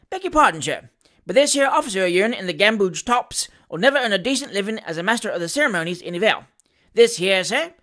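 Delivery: lively, cheerful Cockney patter with pitch swings; chatty servant addressing his betters; cheeky Cockney manservant. Acted. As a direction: butt in with breezy familiarity. Voices cockney Mister Weller.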